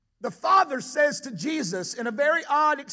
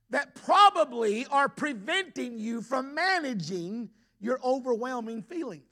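ordinary talk

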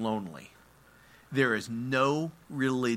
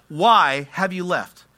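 loud, drawn-out cry to God; anguished raised-voice demand; yelling out; 6 semitones higher, 12 dB louder, slower than usual. Acted. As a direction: yell it slowly, pitched up in anguish. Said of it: "He is yelling out".

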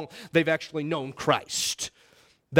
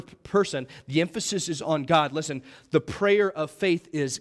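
ordinary talk

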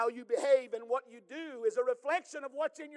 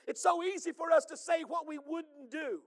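rising, louder preaching voice that builds to a shout; full-force preaching; 6 semitones higher, 6 dB louder than usual. Acted. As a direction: preach it out to the congregation.

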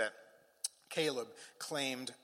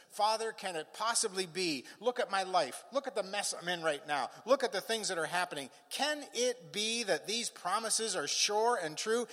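higher, searching questions in a preacher's delivery; acted pleading; 7 semitones higher, 4 dB louder than usual. Troubled believer asking the Father, acted pleading. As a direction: plead for an answer, pressing each question.

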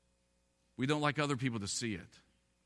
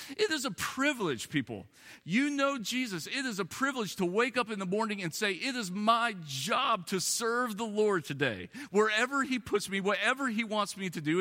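preaching at full force; 8 semitones higher than usual. Acted. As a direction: preach it with rising fervor.

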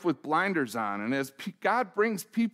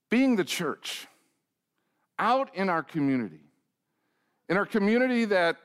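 ordinary talk, nothing marked

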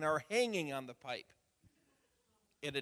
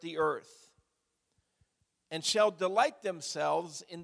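loud, measured Bible reading with firm emphasis; scripture reading; 4 semitones higher, 9 dB louder than usual. Acted. Pastor reads a psalm to his congregation, solemn proclaiming. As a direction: proclaim the psalm in deliberate phrases, pausing between them.

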